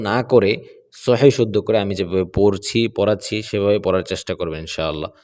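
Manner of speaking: ordinary talk, nothing marked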